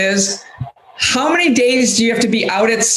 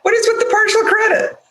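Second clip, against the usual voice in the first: higher, fast mock outrage; indignant; 13 semitones higher, faster than usual. Real, not acted. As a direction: demand it again, indignant. Repeats the complaint louder.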